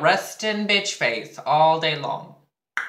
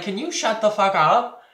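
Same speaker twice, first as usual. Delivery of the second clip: louder, higher mock snap at him; retold angry snap; 8 semitones higher, 6 dB louder than usual. Telling off the rude classmate, a sharper mock snap. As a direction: bite it out at him, half-acted. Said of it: "I was like".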